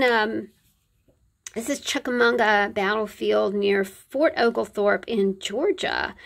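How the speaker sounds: normal talking voice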